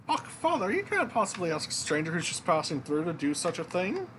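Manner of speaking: high indignant princess voice; a young princess protesting, pitched high. Acted. Princess scolding the king over hiring a stranger, in a high voice. Princess objecting to her father.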